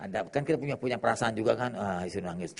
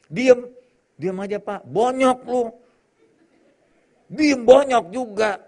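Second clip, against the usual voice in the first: mock threat; 8 semitones higher, 6 dB louder, slower than usual. Preacher mimics threats.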